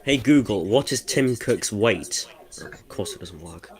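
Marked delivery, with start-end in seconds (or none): 0.0-2.3 talking to voice assistant. Real, not acted. Slow query to the Google assistant.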